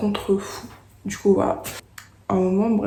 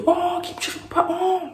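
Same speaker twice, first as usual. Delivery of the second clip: moqueries aiguës des copains; lance-le très haut, comme une bande qui charrie; potes qui chambrent; 11 semitones higher than usual. Retold or imitated. (copains qui se moquent, voix très aiguë)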